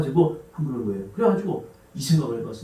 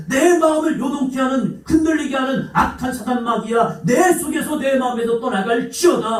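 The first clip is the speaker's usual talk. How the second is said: preaching at full force; 8 semitones higher than usual. Acted. Pastor praying aloud over congregation, fervent shout. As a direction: rebuke the devil with full force.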